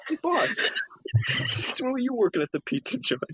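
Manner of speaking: very high, whiny comic henchman; hammed-up dim sidekick voice; baffled Luca. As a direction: ask it in innocent confusion, way up high. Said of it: "the Luca joke".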